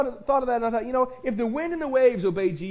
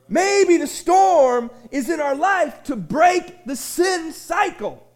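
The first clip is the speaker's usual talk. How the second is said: ordinary talk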